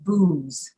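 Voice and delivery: booze ending in a buzzing Z; falling booze with a Z sound; voiced Z. Shown as a demonstration. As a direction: say booze with voice on the final Z. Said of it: "when you see the Z E".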